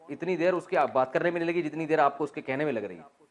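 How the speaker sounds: ordinary delivery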